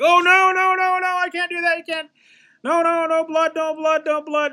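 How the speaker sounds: very high, loud squeaky panic; panicked protest. Acted. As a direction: squeal the refusal in a shrill falsetto panic. Scared Jehovah's witnesses refusing blood.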